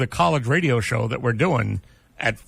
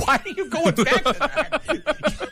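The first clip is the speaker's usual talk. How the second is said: laughing; 10 semitones higher, slower than usual. Real, not acted. Laughing while teasing co-host.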